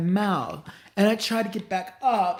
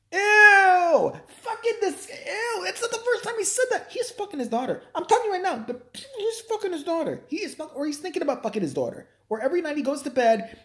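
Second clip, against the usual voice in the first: high-pitched hammed-up revulsion; disgusted outburst; 13 semitones higher than usual. Comic revulsion at the daughter remark.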